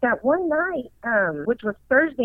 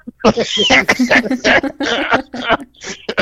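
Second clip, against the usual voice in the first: laughing; 2 semitones higher, 4 dB louder than usual. Real, not acted. Storyteller amused by brother's shut-up, long real laugh.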